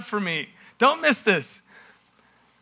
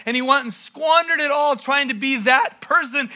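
ordinary talk, nothing marked

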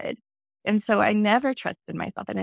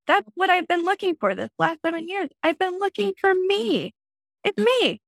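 retold excited scream; 11 semitones higher than usual. To friend Rebecca on the beach, high excited mock scream. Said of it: "I just started screaming at her".

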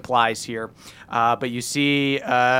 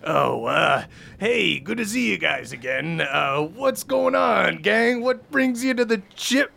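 orc pirate voice; 6 semitones higher than usual. Acted. GM voices the drunk orc Yarn.

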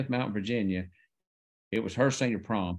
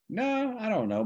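high surprised denial; 12 semitones higher than usual. Retold or imitated. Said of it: "I'm like".

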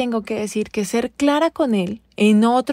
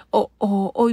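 ordinary talk

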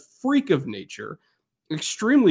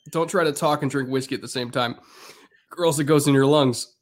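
normal talking voice

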